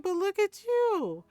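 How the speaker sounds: high, loud, drawn-out exclamation; admiring retort. Retold or imitated. Friend answers the husband about Ron, admiring retort.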